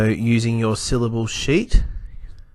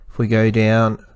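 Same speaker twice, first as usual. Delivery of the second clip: normal talking voice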